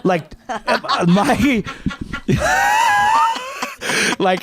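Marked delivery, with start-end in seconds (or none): 1.7-4.1 squealing laugh, way up high; high laughing shriek. Real, not acted. Laughing at the dandruff-covered stranger hugging her. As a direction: break into a high helpless laugh.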